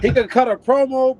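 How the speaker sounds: laughing through the words; a laugh bubbling under the line. Real, not acted. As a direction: chuckle it out as you speak. Laughing while describing cursing.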